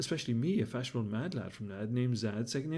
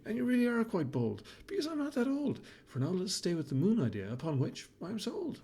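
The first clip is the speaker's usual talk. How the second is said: the cat replying in a higher, lightly voiced tone; a touch of a prim, amused cat; cat Malachi; 6 semitones higher than usual. Malachi answers the baboon.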